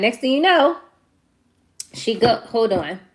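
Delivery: normal talking voice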